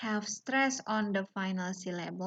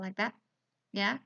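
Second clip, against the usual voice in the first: ordinary talk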